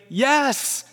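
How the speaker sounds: high, long-drawn yes; eager yes. Acted. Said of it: "lead the parade on our tiptoes".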